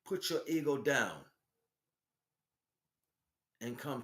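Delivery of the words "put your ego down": usual speaking voice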